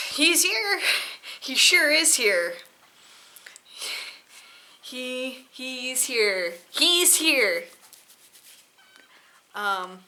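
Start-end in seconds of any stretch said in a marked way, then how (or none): 0.1-7.6 loud, exasperated groaning repetition; fed up, throwing your hands up. Real, not acted. Exasperated rant about Kylo Ren.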